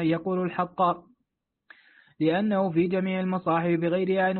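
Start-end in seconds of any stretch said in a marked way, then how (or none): none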